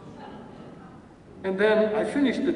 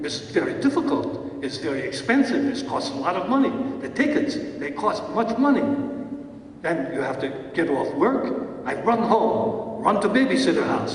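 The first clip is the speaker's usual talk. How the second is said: put-on David Itkin's Russian accent; 2 semitones higher than usual. Acting teacher voicing David Itkin's complaint about theatergoing, Russian accent. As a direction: grumble through the chores in broken Russian-accented English. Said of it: "forgive my Russian accent, it's not so hot".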